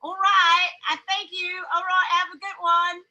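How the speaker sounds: chirpy put-on British accent. Woman showing friend her office British act, British accent. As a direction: play a polite British tea server. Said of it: "gonna go in there talking British".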